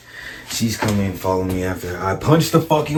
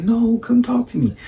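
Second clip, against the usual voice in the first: high-pitched mock plea; whiny imitation, pitched up; pleading girlfriend; 9 semitones higher, 3 dB quieter than usual. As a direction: imitate a girlfriend begging in falsetto.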